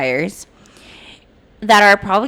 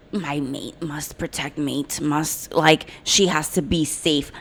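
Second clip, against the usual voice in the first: mock caveman mate; 6 semitones lower, 8 dB quieter, slower than usual.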